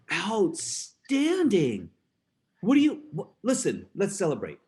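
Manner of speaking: exaggerated praise. Dad praising kids' grades.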